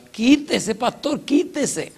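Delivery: high, strained voice begging to be let go; a cornered man whining to get past; bandit pleading. Retold or imitated. Bandit begs the pastor to move, high and strained.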